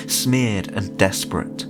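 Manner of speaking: plain reading voice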